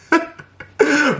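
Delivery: high burst of laughter; real high-pitched laugh. Real, not acted. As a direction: crack up at your own quitting. Laughs at giving up.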